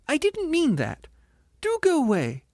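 very high indignant ghost voice; distressed squealing ghost. A narrator's reading. Ghost rebuking the spirit, squealing indignantly. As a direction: plead indignantly, far up high.

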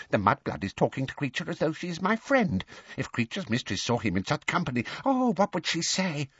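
a quick, hissing elf rant; fast furious muttering. A narrator's reading. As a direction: rush the muttering, spitefully. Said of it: "muttered faster and more furiously than ever".

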